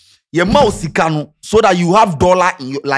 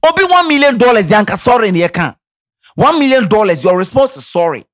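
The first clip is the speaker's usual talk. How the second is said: indignant outburst; 6 semitones higher, 5 dB louder than usual. Real, not acted. Ranting about million-dollar losses.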